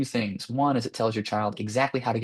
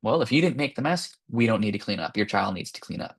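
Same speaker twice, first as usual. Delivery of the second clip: normal talking voice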